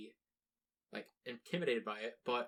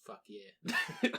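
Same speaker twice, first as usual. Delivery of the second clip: laughing cheer; 6 semitones higher, 4 dB louder than usual. Real, not acted. Laughing end of bit.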